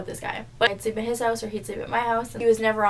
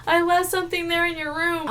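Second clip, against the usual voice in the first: high, whiny pleading; mock crying; 6 semitones higher than usual. Boyfriend pleads after being nudged out the door, mock crying. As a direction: imitate a tearful ex begging to be let back in. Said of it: "crying, and he's like".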